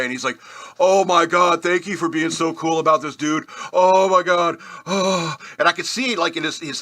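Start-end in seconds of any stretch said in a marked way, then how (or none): 0.8-5.4 put-on loud, higher gushing thanks from a shaky teen; scared teen driver gushes relief; relieved nervous kid.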